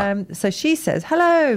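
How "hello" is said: bright sung-out hello, much higher; cheery hello. Retold or imitated. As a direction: call out the greeting brightly. Reading tweet greeting aloud.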